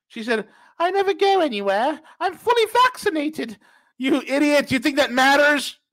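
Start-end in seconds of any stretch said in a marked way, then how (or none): 0.8-2.0 high whiny mock voice, louder; mock the vaccinated woman. Mocking woman quoted in news. 2.2-3.6 high, louder mocking voice; quick mock of the woman. First take of quote. 4.0-5.8 angry mocking. Real, not acted. Yelling at quoted woman.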